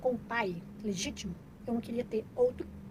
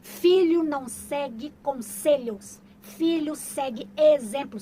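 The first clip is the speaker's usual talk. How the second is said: slow, loud, weighted proverb; slow emphatic motto; 2 semitones higher, 8 dB louder, much slower than usual. Real, not acted. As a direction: spell out the lesson word by word.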